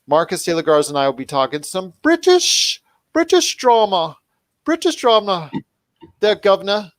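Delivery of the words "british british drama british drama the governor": high-pitched, badly faked British accent; horrible British accent. Teasing the co-host about British dramas, bad British accent. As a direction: put on a hammy mock British voice. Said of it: "my British accent".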